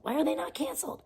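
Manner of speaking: high, soft, incredulous question; hushed disbelief. Real, not acted. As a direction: ask it softly, almost to yourself. Repeats outraged question.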